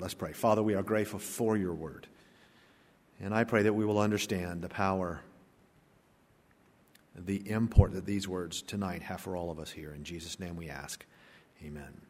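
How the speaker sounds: soft, low prayer voice, dropping to near silence at the amen; hushed prayer. Acted. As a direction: pray quietly and humbly to the Father. Leads a closing prayer.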